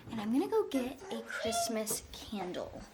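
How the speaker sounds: soft unvoiced whisper. Real, not acted. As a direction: whisper it to yourself. Whispered aside while fetching.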